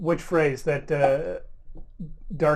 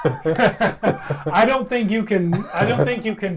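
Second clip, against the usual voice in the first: laughing through the words, loud and higher; real laughing; 6 semitones higher, 7 dB louder, slower than usual. Real, not acted. Player objecting to turning something into a sin, laughing. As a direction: start the sentence through a burst of laughter. Table breaks up laughing mid-discussion.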